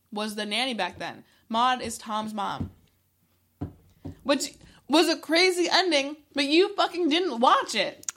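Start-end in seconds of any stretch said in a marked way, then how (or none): 4.2-8.0 higher, louder, exasperated scolding; exasperated outburst. Real, not acted. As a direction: vent at a friend who skipped the ending. Scolding friend for not watching.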